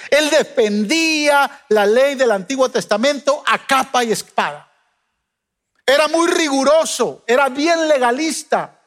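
measured preacher's cadence, rising on key words; sermon voice, steady and earnest; preaching. Acted. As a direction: preach it to the congregation. Preaches to the congregation.

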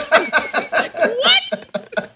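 squeaky high incredulous laugh-talk; mock the clueless with a laugh; laughing mock disbelief. Mocking clueless Brexit voters.

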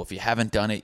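ordinary talk

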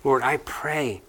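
a pastor praying for the congregation; closing prayer. Acted.

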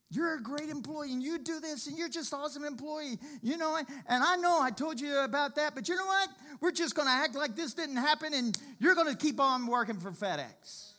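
high, bright boss voice bragging on him; praising boss. Retold or imitated. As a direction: play the boss gushing about his worker.

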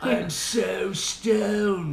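slow, stoned Californian; California accent. Acted. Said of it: "how do they talk?".

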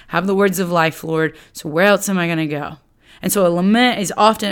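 ordinary talk, nothing marked

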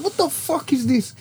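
high, softened mock bewilderment; bewildered reaction. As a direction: squeak it quietly in disbelief. Retelling his reaction to alarm.

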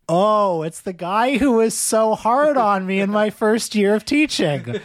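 slow, high, rueful recognition; teacher recognizing. Retold or imitated. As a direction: say it slowly, half amused. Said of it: "looked at me and went".

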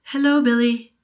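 lightly voiced round old woman genie; kindly genie. Genie greeting the boy, lightly voiced old woman.

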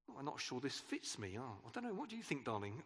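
low, soft fretting over a tight outfit; fussing over clothes. Pretend. Asking a spouse about new clothes, soft and unsure. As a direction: mumble to yourself in the mirror, unsure.

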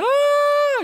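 long, high, loud coaster scream; drawn-out high-pitched "ah" yelled out; mock scream. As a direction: scream like the ride just took off. Mimicking roller coaster riders screaming.

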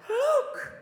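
very high, loud yelp; hammed-up shout of discovery; mock amazed cry. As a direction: yelp it as if startled by a wonder. Acting out another amazed discovery.

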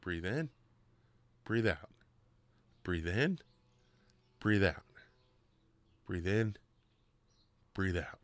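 softly coaching breath in and out; calm breathing coach. Retold or imitated. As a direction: soothe a nervous diver through each breath. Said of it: "he goes".

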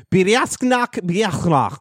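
high, drawn-out fake Polish; mock Polish. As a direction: imitate a Polish man saying the title slowly. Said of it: "he was telling me the actual Polish name".

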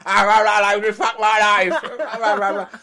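high, loud, slowed mock despair; mock despairing rant. Imitating a lonely host ranting in the dark. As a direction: wail it like a broken man in the dark. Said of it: "me sitting in a dark room going".

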